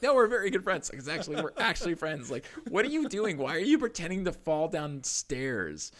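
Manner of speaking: high, loud mock protest at his mom's question; half-laughing, indignant pitch jump; exasperated retort. Son telling mom they are real friends, high exasperated protest. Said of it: "And I'm like".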